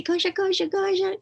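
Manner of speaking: high, loud cooing to an animal; high-pitched pet coaxing. Retold or imitated. Child greeting a skunk like a kitty, high coaxing. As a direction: squeak it like a kid calling kitty.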